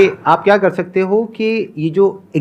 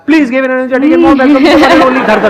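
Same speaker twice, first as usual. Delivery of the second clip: slow hammed-up stage announcer, laughing through it; mock a memorised show welcome; rote announcer intro; 4 semitones higher, 4 dB louder, slower than usual. Host mocking his own memorised guest welcome, laughing. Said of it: "जैसे मैं नहीं बोलता यहाँ पे".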